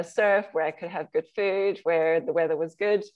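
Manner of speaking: normal talking voice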